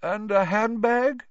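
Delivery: high, slow knowing prompt. A narrator's reading. Teacher prompting Neville with a hint, slow and high. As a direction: lead him gently to the answer. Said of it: "prompted Professor Lupin".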